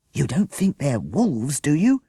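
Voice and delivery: frightened plea; alarmed. A narrator's reading. Jessica grabbing Akbar's arm, alarmed. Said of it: "cried Jessica".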